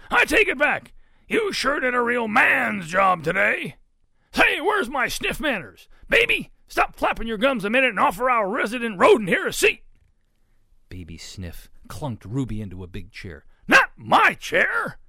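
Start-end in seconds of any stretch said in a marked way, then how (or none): 0.1-9.8 high, loud, hearty bluster; booming Papa pig. A narrator's reading. Papa pig praises Ruby and orders Baby around, booming. 13.7-15.0 slow, high, very loud protest; shout. A narrator's reading. Papa pig sees Ruby in his chair, shouting. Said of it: "shouted Papa Sniff".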